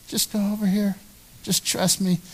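soft, hushed coaxing; God quietly asking for trust; gentle reassurance. Acted. God reassuring the hesitant believer, softly.

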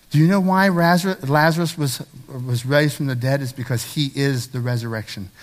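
normal talking voice

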